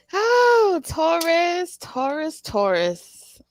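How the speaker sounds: high, loud exclamation; excited wail. Real, not acted. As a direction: cry out over what the cards show. Reacting to the cards.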